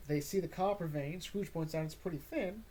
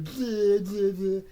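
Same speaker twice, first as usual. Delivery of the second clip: loud, higher mocking kid's blah; 5 semitones higher, 11 dB louder, much slower than usual. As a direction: blow off your father with a loud jeer. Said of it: "And Johnny goes".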